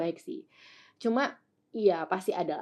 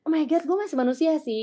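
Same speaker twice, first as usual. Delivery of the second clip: exasperated retold exclaim; 9 semitones higher, 7 dB louder than usual. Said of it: "Jadi gue kayak".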